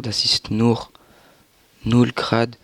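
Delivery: ordinary talk, nothing marked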